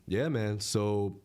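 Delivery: ordinary delivery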